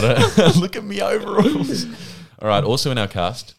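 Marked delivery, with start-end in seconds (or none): 0.6-1.9 Blinky Bill voice. Retold or imitated. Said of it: "Sound like Blinky Bill".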